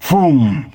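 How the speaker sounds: punchy boom for the car racing by; sudden boom sound effect. Retold or imitated. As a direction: hit it like an impact. Car speeds past the cop.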